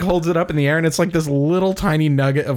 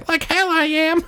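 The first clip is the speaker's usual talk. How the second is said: put-on defiant old panner. NPC refusing robbery.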